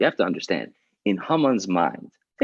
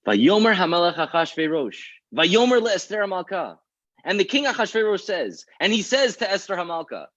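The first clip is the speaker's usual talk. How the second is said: high, ringing, declaimed outrage; furious recitation; 10 semitones higher than usual. Acted. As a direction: declaim each phrase like an enraged king. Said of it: "'Cause he's furious".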